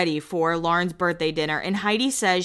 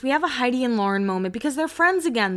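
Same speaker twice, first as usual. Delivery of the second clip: ordinary talk, nothing marked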